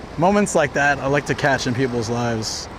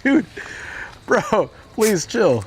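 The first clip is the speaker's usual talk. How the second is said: very slow, higher drawn-out plea; mock pleading; 7 semitones higher, much slower than usual. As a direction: beg him to calm down, stretched out. Telling the monk to chill.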